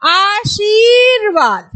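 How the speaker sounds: आशीर्वाद very slow, र before वा; teacher giving the correct form; correct demo pronunciation. Shown as a demonstration. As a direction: draw out आशीर्वाद clearly as the right answer. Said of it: "तो क्या बोलेंगे".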